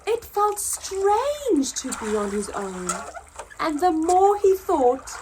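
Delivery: lively storybook narration, rising and falling; reading to children. Acted. Teacher reads Lost and Found to pupils, storytelling voice. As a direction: tell the story to a class of kids.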